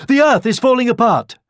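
high-pitched cry of alarm; panicked shout. A narrator's reading. Scared hare warning another hare, shouting. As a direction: shout it high and loud in a fright. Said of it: "just for a second to shout".

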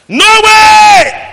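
high, loud, drawn-out refusal; defiant shout. Acted. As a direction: cry it out in defiance.

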